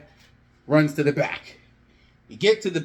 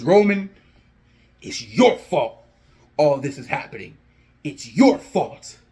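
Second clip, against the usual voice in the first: furious; 4 dB louder than usual. Acted. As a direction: blame him to the camera, punching key words. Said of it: "He's just furious".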